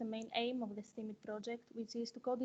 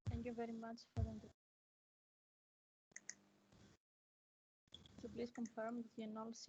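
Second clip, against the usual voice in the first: faint, far from the mic; too soft to hear; barely audible; 9 dB quieter than usual. Real, not acted. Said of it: "your voice is very, very low".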